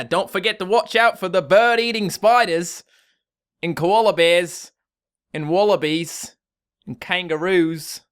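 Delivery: broad put-on Australian accent, starting high and loud. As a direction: greet them like a hammed-up Aussie. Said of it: "do your best Australian accent".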